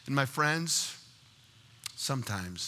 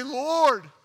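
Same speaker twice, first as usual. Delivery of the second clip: high, louder plea; crying out to God; 9 semitones higher, 5 dB louder than usual. Acted. Broken parent surrendering to the Lord, crying out. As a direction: call upward in surrender.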